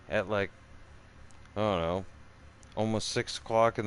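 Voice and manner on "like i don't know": ordinary delivery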